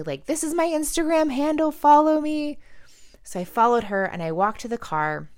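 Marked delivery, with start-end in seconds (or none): 0.3-2.6 high, loud call-out; raised, high-pitched shout, imitating her; imitated yell. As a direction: yell it after someone who is leaving. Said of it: "she yells at me".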